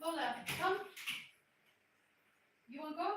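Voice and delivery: high, soft pet-coaxing voice; coaxing a dog. Real, not acted. Owner outside urging her dog to come, coaxing. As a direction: coax the dog along with lifted questions.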